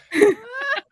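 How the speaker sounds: high, laughing and breaking; high laugh after the retold punchline. Real, not acted. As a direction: crack up at the end.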